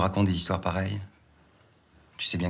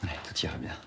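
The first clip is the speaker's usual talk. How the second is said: quiet, low, almost whispered; soft and doubtful; subdued echo; 4 semitones lower, 7 dB quieter than usual. Acted. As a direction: say it under your breath, unconvinced. Worried husband echoes doctor's reassurance.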